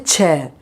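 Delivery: "chair" with a strong puff on ch; aspirated ch. Shown as a demonstration. Teacher corrects back to the right way, "chair" with aspirated ch. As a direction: press the first sound of "chair". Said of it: "you give pressure on that first letter".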